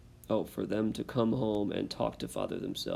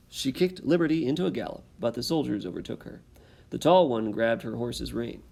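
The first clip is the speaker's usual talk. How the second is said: lively read-aloud, louder with rising tension; story narration; 2 semitones higher, 4 dB louder than usual. A narrator's reading. Teacher reading the exciting last page to students, dramatic storytelling. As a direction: build the chase for the listening kids.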